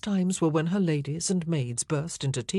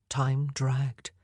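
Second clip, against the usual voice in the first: usual speaking voice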